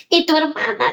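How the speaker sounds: high, anxious question; retold worry. Mother asking crying daughter about her sister, anxious. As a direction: re-enact a worried parent checking.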